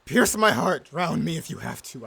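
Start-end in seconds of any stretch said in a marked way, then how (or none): 0.0-0.9 loud anguished plea to be killed; desperate shout. Acted. Beaten captain begging his captor to kill him. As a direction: throw the demand out in despair. Defeated captain begs death.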